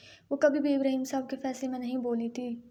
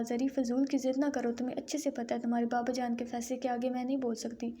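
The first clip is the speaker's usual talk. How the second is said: ordinary delivery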